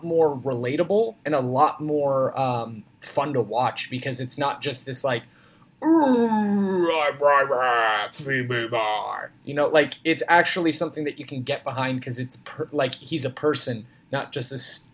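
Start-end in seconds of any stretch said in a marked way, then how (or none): 5.8-9.3 drawn-out monster roar sliding downward; Ryback impression. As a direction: bellow it slowly like a big cartoon wrestler. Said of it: "That was a pretty good Ryback impression".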